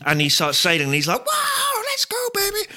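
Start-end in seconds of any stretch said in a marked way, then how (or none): 1.2-2.7 high excited shout; whooping. Pretend. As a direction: cheer it out setting sail. Richard setting sail.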